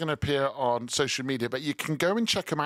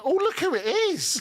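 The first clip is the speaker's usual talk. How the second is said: delighted surprise; 13 semitones higher than usual. Real, not acted. Kids appear on camera.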